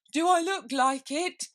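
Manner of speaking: very high, loud, slow woeful voice; sad knave. Acted. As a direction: lament it like a cardboard knave. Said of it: "shook his head sadly".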